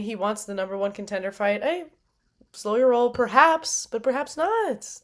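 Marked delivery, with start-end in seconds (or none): none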